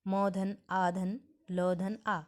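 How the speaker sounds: very slow, each sound of "Mala" set apart; slow spelling out. Shown as a demonstration. Teacher breaking a word into sounds for students, very slow. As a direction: build "Mala" piece by piece, slowly. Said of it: "जैसे माला".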